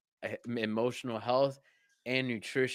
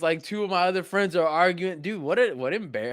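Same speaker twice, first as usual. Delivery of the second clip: usual speaking voice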